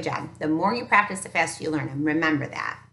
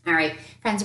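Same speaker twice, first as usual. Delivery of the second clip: normal talking voice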